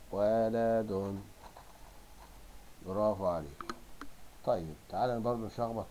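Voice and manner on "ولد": ولد very slow, in parts wa-la-dun; drawn-out, separated syllables of ولد. Shown as a demonstration. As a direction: stretch each syllable of ولد evenly. Same word broken into syllables.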